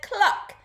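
short, high hen cluck. A narrator's reading. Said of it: "and the hen said".

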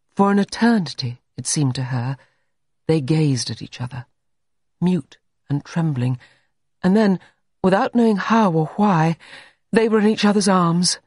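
ordinary delivery, nothing marked

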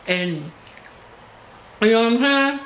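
ordinary delivery

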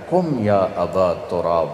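slow, tender call to wake him; the Prophet lovingly waking Ali, slow and tender. Retold or imitated. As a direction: gently rouse a beloved son-in-law. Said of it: "بڑے پیار سے کہا".

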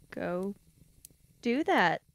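ordinary delivery

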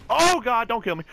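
high, loud panicked yelp; panicked shout. Real, not acted. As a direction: yell in real panic. Panicked under grenade fire.

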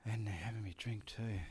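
soft confiding whisper; unvoiced whisper; whispered aside. Real, not acted. Aside about the filming.

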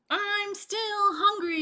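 high, loud gleeful yell. Acted. Bullfrog yelling back while fleeing, high and loud. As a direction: taunt them as you run. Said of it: "yelled Bullfrog".

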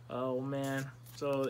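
usual speaking voice

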